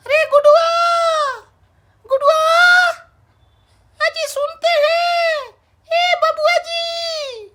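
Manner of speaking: long drawn-out summons; calling out. Acted. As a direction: call across the house, stretching the names. Mother calling out to family.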